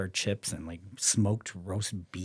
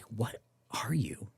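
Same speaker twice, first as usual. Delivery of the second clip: slow, hushed, awed; stunned whisper; 2 semitones higher, 4 dB quieter, slower than usual. Retold or imitated. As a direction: whisper it, spooked. Friend stunned by his prediction.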